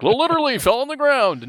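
high, loud, hammed-up 'Literally fell on the ground'; figurative 'literally'. Shown as a demonstration. Storyteller mocking loose use of 'Literally', high and overblown. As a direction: gush 'Literally' high, as mere exaggeration. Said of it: "This was not a figurative literal".

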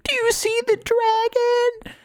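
mock squeal, stretched out and sky-high; high-pitched squeal. Kid looks right at him during the movie, high-pitched squeal. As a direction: imitate an overexcited kid squealing slowly. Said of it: "in a high-pitched squeal".

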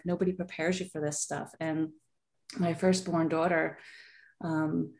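ordinary talk, nothing marked

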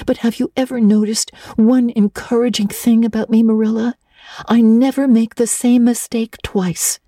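put-on high girlish voice, tearful and fluttering with embarrassment; young Anne voice. Anne begging Marilla not to face Missus Allen, high and teary. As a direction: play the mortified little orphan girl, stumbling over words.

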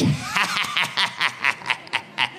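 hammed-up staccato cackle in bursts; imitated raucous, repeated laugh; mock cackle. Singer breaks the spell after her song, mock cackle.

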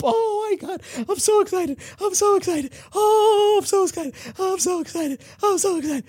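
put-on very high, squealing girlish voice; high-pitched giddy repeating of so excited; squealing excitement. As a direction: squeal it like a starstruck fan. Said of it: "Kim's just getting excited".

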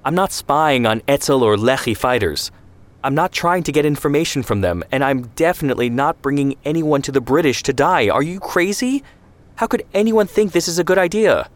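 rising to a high, loud indignant protest; outraged protest. Acted. Zvi outraged at spying request.

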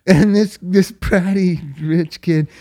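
usual speaking voice

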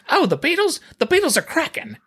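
put-on high old man voice, British lilt. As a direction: play a chirpy old Englishman. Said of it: "there's an old man who they interviewed".

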